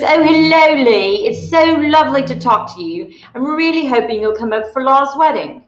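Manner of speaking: a friend on the phone doing posh British charm; British accent. Retold or imitated. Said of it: "cue my British accent".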